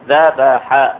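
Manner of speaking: 把ذبح读作 dhabaḥa，末尾带开口音; ذبح with final fatha. Shown as a demonstration. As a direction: say ذبح as dhabaḥa, ending on a clear -a. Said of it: "تنطق هكذا".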